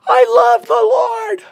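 very high, loud, slow wail; full-force drawn-out scream of scripture; screaming to God. Retold or imitated. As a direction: cry out for help in long, held phrases. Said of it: "screaming to him".

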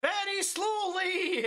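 slow mock. Mocking the slow line.

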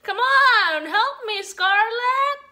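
calling for help. Real, not acted. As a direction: call out loudly for the little one to help. Calls to daughter for help.

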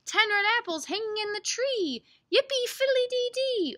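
high, singsong picture-book refrain; reading to children. Acted. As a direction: read it as a lilting rhyme for little ones.

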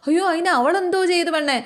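a grandmother jumping in, alarmed; alarmed old woman. Retold or imitated. Grandmother asks the shouting daughter what happened, alarmed.

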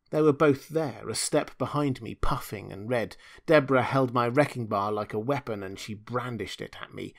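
ordinary delivery, nothing marked

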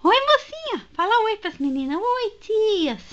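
high cooing greeting. Real, not acted. Owner cooing at her ferret in high baby talk.

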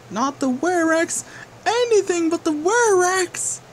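high panicked shriek; panicked scream. Acted. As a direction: scream in terror at the Werex. Villain panics at the Werex.

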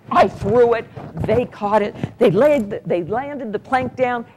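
usual speaking voice

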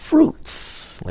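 fruits with a held stop, then a hissed s; stopped, hissed ending. Shown as a demonstration. Teacher shows plural fruits, hissed ending. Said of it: "nín hơi xì nó".

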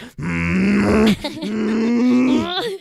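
long rising "mmm" of mock fury; angry child growl. Imitating an angry child sitting there, mock furious growl. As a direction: strain out a pouting, growly hum. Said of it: "an angry child that, like, sits there".

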